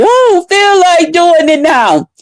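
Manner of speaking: hammed-up crying little brother; mock crying whine. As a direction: whine it like a pouting kid. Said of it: "he even started to cry".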